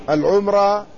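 العمرة with m straight into r, long ā ending; first variant. Shown as a demonstration. Lecturer listing three variants, العمرة as al-ʿumrā. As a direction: say العمرة as al-ʿumrā, u then silent m. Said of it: "فيها ثلاث لغات".

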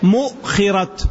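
"مؤخرة" as mu'-khirat, distinct stop after mu; hamza sakina. Shown as a demonstration. Teacher repeating the first form for students, "مؤخرة" pronounced mu'-khirat. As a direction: break at the hamza, then khi in "مؤخرة". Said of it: "وبعد همزة ساكنة".

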